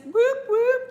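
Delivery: high, loud whoop-whoop cheer; loud party cheer; celebratory whoop. Real, not acted. Cheering an answer from chat.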